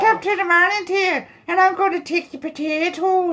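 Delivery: very high-pitched comic Irish voice; squeaky-high Irish caricature; mock Irish accent. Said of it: "get myself an Irish one".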